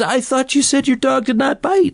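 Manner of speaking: high, exaggerated French Clouseau accent; Clouseau's accent. S0 retells the Pink Panther dog joke, in a high Clouseau accent. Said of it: "this strong Austrian accent you hear".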